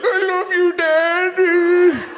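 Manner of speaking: put-on high, loud whimpering child voice; sick kid cry talk. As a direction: play a queasy little kid pleading sweetly. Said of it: "kinda cry talk at you".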